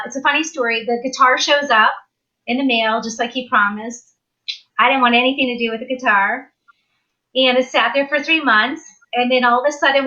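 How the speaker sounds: ordinary talk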